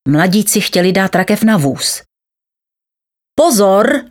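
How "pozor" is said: higher, mild warning cry; exclaimed warning. A narrator's reading. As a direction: call out a sudden warning. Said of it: "vykřikl doktor Heribert". (varovné zvolání, výš posazené)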